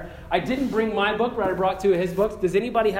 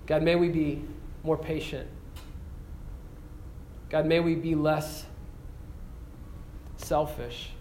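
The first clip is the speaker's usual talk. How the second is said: closing prayer; 4 dB quieter than usual. Acted. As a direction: pray softly and earnestly over the students. Closing prayer after sermon.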